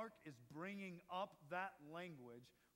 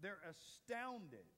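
normal talking voice

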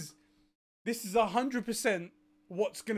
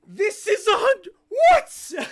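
high, loud half-sobbing yell of joy; crying-laughing shout; 11 semitones higher, 11 dB louder, slower than usual. Real, not acted. Fan seeing the Snyder Cut confirmed, sobbing with joyful shouts. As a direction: break into a tearful laughing shout.

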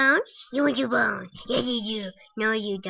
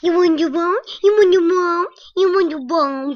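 high, loud coaxing; excited dog call; 5 semitones higher, 9 dB louder than usual. Real, not acted. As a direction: coax the dog in eagerly. Calling dog to come.

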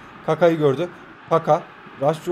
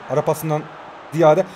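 ordinary talk, nothing marked

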